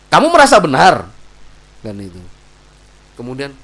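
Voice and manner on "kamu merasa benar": very loud, high challenge; acted quarrel at full volume; raised-voice accusation. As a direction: shout it accusingly in an argument. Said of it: "Nggak usah seperti itu".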